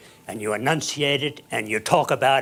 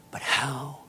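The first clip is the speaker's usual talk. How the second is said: ordinary talk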